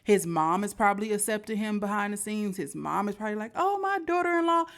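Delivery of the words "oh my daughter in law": doting mom. Retold or imitated. Mom imagined accepting daughter-in-law.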